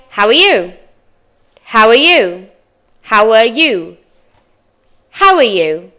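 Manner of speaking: "How are you?" run together, "are" shrunk to a quick "uh"; native linked form. Shown as a demonstration. Teacher shows learners how natives say it, linked and reduced. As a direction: link "How are you?" smoothly as "howuh-you". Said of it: "A native person might say".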